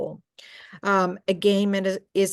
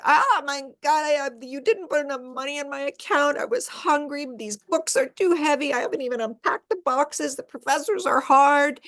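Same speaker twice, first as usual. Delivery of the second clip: a bit higher, complaining but less whiny; mildly upset daughter; 6 semitones higher than usual. Acted. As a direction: let her vent her week. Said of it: "So daughter".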